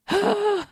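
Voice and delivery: high-pitched acted gasp; mock gasp. Podcaster acts out Oak hearing the story is his, mock gasp. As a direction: gasp as the character would, high and put-on. Acting out Oak's self-centred reaction.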